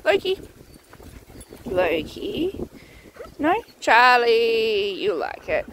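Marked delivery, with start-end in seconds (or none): 0.0-0.4 short, high-pitched call of "Loki"; quick call. Real, not acted. Calling a dog for a treat. 1.7-2.7 "Loki" low and softer, stretched to a rise; drawn-out call. Real, not acted. Calling the same dog once more.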